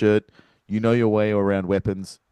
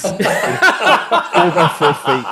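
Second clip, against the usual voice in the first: slow words through real laughter, higher; laughing; 6 semitones higher, 4 dB louder, slower than usual. Real, not acted. Host cracking up at his own earthquake joke, laughing.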